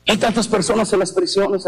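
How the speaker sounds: ordinary talk, nothing marked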